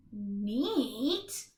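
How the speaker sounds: usual speaking voice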